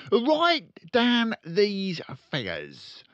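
loud, high, very slow dictation; slow dictating father. Acted. Father dictating sums to his son, slow and loud. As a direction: spell out each word for the boy.

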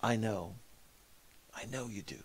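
soft, teary reply, gently retold; a hint of tears, trailing off; tearful, quiet. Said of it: "with tears in his eyes said".

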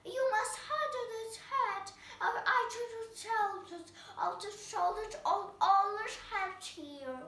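shrill royal demand from a tyrant; queen shouting. Acted. Queen demanding the cat's head, high shout. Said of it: "The queen shouted".

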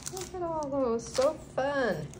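high-pitched happy gush at the stickers; delighted. Real, not acted. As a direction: coo over them with delight.

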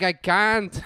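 loud, high, helpless mock Mexican accent; put-on Mexican accent. Drunk tourist protesting to his buddy, mock Mexican accent. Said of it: "Stop talking with a goddamn accent".

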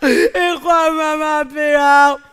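put-on wailing little boy, high and loud; crying child. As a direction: bawl it out like a hurt kid. (niño llorando y gritando a la abuela)

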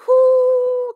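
loud, high drawn-out whoo; high whooping exhale; long whoo. Real, not acted. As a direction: let out a big high whoo over a shocking twist. Reacting to the plot twist.

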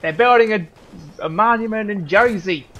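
loud, high New Jersey shout; New Jersey accent. Retold or imitated. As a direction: yell it like a Jersey local calling Ma. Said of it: "That's my best Jersey impression".

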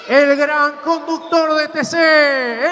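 ordinary talk

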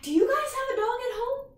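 usual speaking voice

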